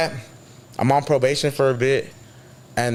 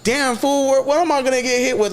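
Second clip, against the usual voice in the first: much higher, disbelieving cry; hearing about more charges, high disbelief; retold dismay; 11 semitones higher than usual. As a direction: throw the pitch way up in dismay.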